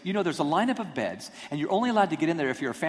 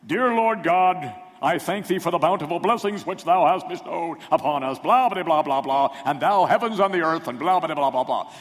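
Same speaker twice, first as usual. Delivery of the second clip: hammed-up solemn prayer voice, louder and higher; pompous reverend voice; 3 semitones higher, 7 dB louder than usual. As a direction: put on the Most High Reverend clergyman for a showy prayer. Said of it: "my voice that was two octaves lower".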